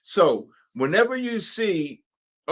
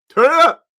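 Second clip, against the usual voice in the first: excited shout; 10 semitones higher, 11 dB louder, faster than usual. Real, not acted. Urging the market chart upward.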